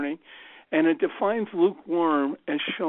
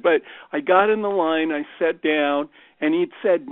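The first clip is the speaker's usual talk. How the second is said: ordinary talk, nothing marked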